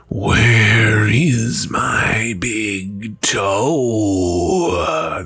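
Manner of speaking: slow breathy moan right at the door; whispered groan. Acted. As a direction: breathe it out long and close. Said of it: "the voice groaned".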